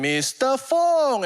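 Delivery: high, slow, drawn-out name call; announcer call. Retold or imitated. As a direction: announce him like a stage host. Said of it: "And then".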